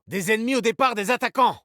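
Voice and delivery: high, quick alarm; urgent warning. Acted. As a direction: call out the danger to the team. Urgent take of callout. (alerte pressante, aiguë et rapide)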